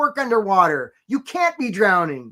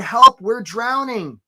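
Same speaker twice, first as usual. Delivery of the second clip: loud, slow, higher call for help; mock cry for help; 4 semitones higher, 7 dB louder, much slower than usual. Employer calling out that they're drowning, slow loud cry. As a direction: cry out like someone going under.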